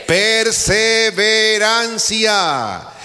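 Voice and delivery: Perseverancia stretched slow and high; drawn-out emphatic word. Shown as a demonstration. Preacher models the answer to congregation, Perseverancia drawn out high. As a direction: lean on Perseverancia, very slowly, high pitch. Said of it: "Dígalo fuerte".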